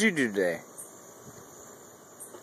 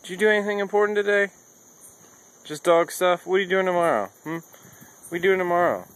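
high, loud pet-talk questions; 8 semitones higher, 3 dB louder than usual. Pretend. Owner quizzing his dog about its plans, high-pitched. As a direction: quiz the dog in a raised voice.

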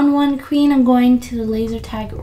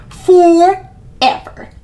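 drawn-out villain forever; 6 semitones higher, 5 dB louder, much slower than usual. Pretend. Mock villain drawn-out word.

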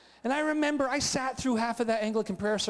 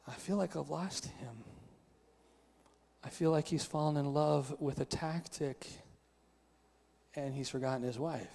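quiet, lower, halting voice of a hurt wife; understated tearful hurt; 4 semitones lower, 5 dB quieter than usual. Acted. Wife confiding in someone she trusts, subdued and sad. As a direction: gently act a wife close to tears, with pauses. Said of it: "begin to cry and to say".

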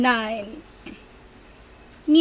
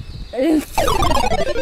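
high laugh; 4 dB louder than usual. Real, not acted.

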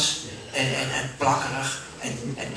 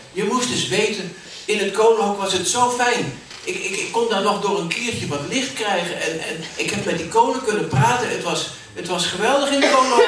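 higher, excited angel voice, laughing at the end; opgetogen engel; 5 semitones higher, 3 dB louder than usual. Acted. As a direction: gush about the coal shed. Kerstengel vertelt opgetogen. (opgewonden, hoge engelenstem)

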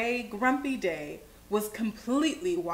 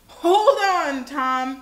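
urgent storybook shout, easing on the name; 2 semitones higher, 7 dB louder, much slower than usual. A narrator's reading. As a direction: shout it to someone in danger. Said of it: "shouted Grandude".

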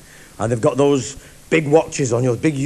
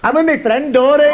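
much higher, bright and gormless; high girlish voice; 11 semitones higher, 3 dB louder than usual. Acted. Girl at the bar answering the cowboy, high silly voice. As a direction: squeak it like a dim factory girl.

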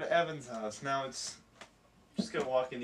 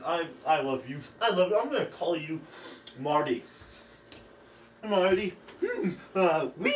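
hammy crying; 5 semitones higher, 6 dB louder than usual. Acted. Overacted emotional farewell, cry.

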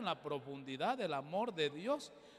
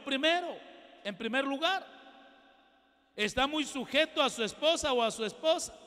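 full-force preaching; 7 semitones higher, 10 dB louder than usual. Acted. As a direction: hammer the point home to the congregation.

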